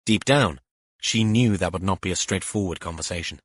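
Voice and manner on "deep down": usual speaking voice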